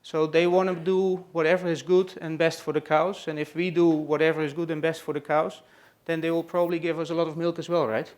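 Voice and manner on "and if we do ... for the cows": normal talking voice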